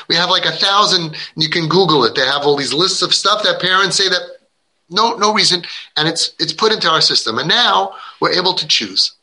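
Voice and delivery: ordinary talk, nothing marked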